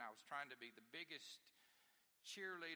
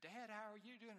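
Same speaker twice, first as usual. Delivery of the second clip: put-on light, higher child voice; kids asking dad; 8 semitones higher, 3 dB louder than usual. Child asking sweaty dad how he's doing, higher. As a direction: chirp it like a concerned kid.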